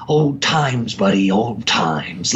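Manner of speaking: low, slow mock sinister voice; slow villain drawl. Imitating the actor's slow delivery for friends, low drawl. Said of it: "he talks like this".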